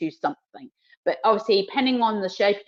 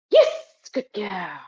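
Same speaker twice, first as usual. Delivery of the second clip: excited praise; much slower than usual. Real, not acted. Praising dog for a correct touch.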